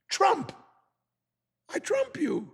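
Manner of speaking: victorious high shout; triumphant shout. Retold or imitated. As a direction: crow over the loser. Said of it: "I'm adding a little bit of inflection".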